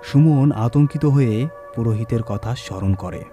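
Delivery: usual speaking voice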